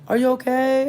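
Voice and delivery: high, loud mock concern; concerned lady. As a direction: play the helpful neighbour asking. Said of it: "She's like".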